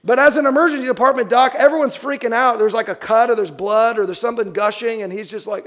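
ordinary talk

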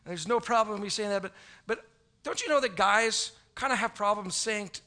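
ordinary talk

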